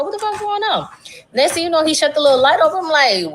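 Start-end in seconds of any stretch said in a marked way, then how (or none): 0.0-0.9 higher, panicky what's-going-on; startled as the lights go off; half-joking alarm; alarmed disbelief. Retold or imitated. Door locked, light off.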